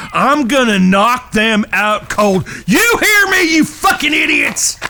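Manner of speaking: raging shout at the brothers who bet on him; furious yell. Acted. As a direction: scream the threat at them. Furious threat after being mocked.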